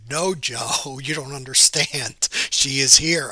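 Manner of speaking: building to a loud insistent she is here. Retold or imitated. Carlos insisting to Joe the lady is still there, growing loud.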